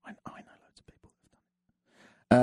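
whispered aside; a whispered confession to the radio audience. Acted. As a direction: whisper it like a secret. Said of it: "what's the point in whispering on the radio?".

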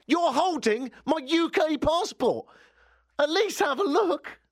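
very high, slow, indignant outburst; exasperated objection. Retold or imitated. Traveller objecting to border guard holding his passport, exasperated. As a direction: protest in a strained, high-pitched mock outrage.

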